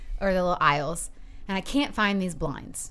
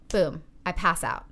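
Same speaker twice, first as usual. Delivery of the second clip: ordinary talk, nothing marked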